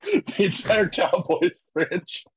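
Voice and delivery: said through a laugh, slowed down; amused, half-laughing delivery. Real, not acted. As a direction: laugh out the words. Laughing while mocking a googled answer.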